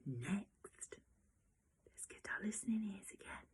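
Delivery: fast, hushed whisper; quick unvoiced whisper for listening time. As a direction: whisper to settle the class in. Teacher hushes class to listen.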